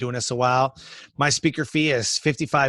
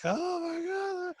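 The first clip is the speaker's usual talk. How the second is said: high, slow, breathless oh my God before going on stage; stage-fright murmur; nervous gasp; 8 dB quieter, much slower than usual. Retold or imitated. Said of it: "you get this, like, the butterflies".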